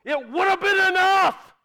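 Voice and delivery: loud, high-pitched, fervent sermon; impassioned preacher building to repeated shouted declarations; full-force preaching. Real, not acted. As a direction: shout each refrain with conviction.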